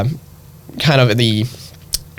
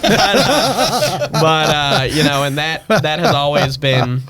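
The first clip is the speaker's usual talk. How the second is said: usual speaking voice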